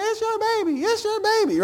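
very high, insistent mock woman's voice; hammed-up talk show mother. To the husband, the mother insisting, high mock voice. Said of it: "She's like".

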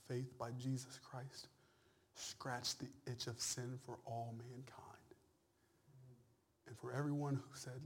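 soft, whispered sermon voice; hushed preaching. Acted. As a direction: draw them in with a hush.

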